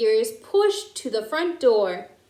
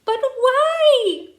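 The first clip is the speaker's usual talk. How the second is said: much higher, louder question; astonished; 13 semitones higher, 5 dB louder than usual. A narrator's reading. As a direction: demand the reason in shock. Grandmother shocked.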